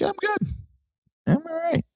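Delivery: very high, soft squeaky refusal; polite no thanks. Pretend. Old head turning down newer bands, squeaky polite refusal. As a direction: squeak it out like a prim polite decline.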